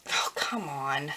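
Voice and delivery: slow, whispered groan of frustration; exasperated whisper. Real, not acted. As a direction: breathe it out slowly in despair. Exasperated at glue again.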